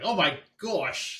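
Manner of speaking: hammed-up Australian accent, high, loud and drawn out; bad Australian accent. Host imitating delight at fan mail from Australia, bad Australian accent. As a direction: gush slowly in a mock Australian accent. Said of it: "Is that Australian?".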